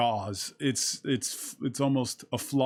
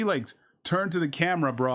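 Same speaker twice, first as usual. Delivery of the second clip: ordinary delivery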